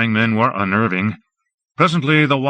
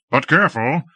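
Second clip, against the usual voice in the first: battle commands; 7 semitones higher than usual. A narrator's reading. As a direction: drive the troops forward. Lord commands troops in battle.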